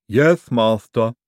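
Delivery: dutiful lisping henchman; lisping servant. Acted. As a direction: answer obediently, drawing out each word. Igor answers a repeated order.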